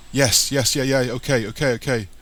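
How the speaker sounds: loud, anxious run of yeses; flustered agreement. A narrator's reading. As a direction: agree in a mild panic. Frightened boy hurriedly agrees.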